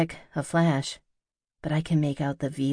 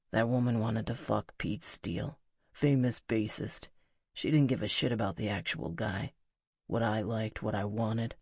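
near whisper; 5 semitones lower, 5 dB quieter than usual. A narrator's reading. Musician confides in her about a fan, low near-whisper. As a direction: lower and softer, confiding male voice. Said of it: "He leans in to whisper".

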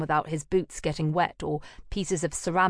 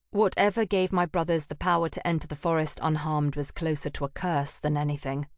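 ordinary delivery

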